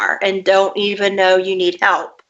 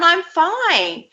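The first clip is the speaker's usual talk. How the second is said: put-on child's voice; 9 semitones higher than usual.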